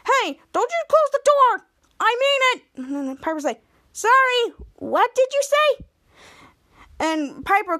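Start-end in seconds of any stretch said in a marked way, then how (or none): 0.1-2.6 acted angry yell. Acted sibling quarrel over a door. 3.9-5.9 high, loud, drawn-out sibling taunt; mock-innocent taunt. Sister taunts brother through closed door.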